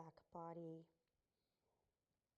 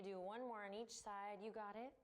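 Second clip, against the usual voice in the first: ordinary talk, nothing marked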